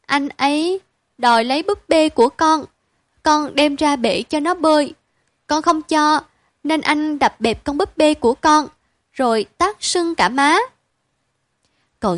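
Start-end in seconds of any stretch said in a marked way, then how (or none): 0.1-10.6 put-on higher childlike voice, close to tears; pouting little girl. A narrator's reading. Little sister complaining to her father, pouting. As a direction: whimper out the complaint. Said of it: "Em Năm miếu máo".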